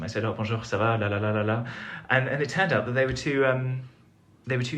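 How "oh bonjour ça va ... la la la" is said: casual French greeting. Retold or imitated. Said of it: "started speaking French to them".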